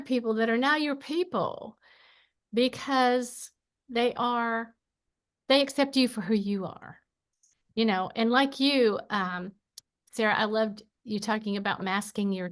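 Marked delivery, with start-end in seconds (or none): none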